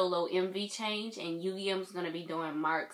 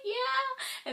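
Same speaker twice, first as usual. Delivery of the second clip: squeaky high yeah. Retold or imitated. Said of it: "I was like".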